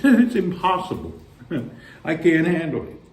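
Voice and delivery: laughing through the words. Real, not acted. Laughing about the cold.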